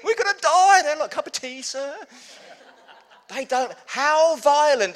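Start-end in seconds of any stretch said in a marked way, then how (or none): 0.0-0.8 very loud, high panicked wail; mock panic. Panicking passengers, acted out. 1.1-2.0 high, chirpy mock flight attendant; breezy service voice amid others' panic; unruffled cabin crew. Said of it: "they don't bat an eyelid".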